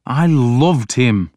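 a drawn-out, slow blurt. A narrator's reading. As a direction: let it burst out, stretching the words. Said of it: "I blurted out".

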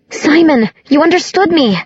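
high, delighted exclamation; joyful high-pitched surprise; relieved. A narrator's reading. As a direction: cry it out in relief. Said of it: "an exclamation of relief".

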